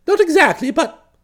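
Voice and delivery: flustered protest. Acted. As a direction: hedge defensively, voice jumping up. Bertie hedging under Florence's questioning.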